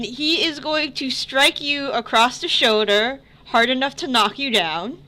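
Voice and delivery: usual speaking voice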